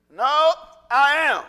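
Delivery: slow, loud, weighty answer; forceful declaration. Acted. Jesus revealing himself to the crowd, forceful declaration. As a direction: declare it loudly, drawing it out.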